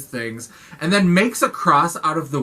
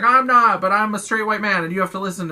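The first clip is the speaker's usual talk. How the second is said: higher and louder, self-important cop; pompous cop; 6 semitones higher, 4 dB louder, faster than usual. Retold or imitated. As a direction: puff up like an entitled official. Cop voiced as pompous man.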